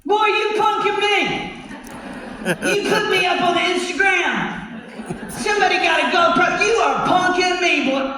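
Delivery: put-on furious dad shouting at the boy; angry father shout. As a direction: yell like he's being pranked. Angry father accuses the suitor.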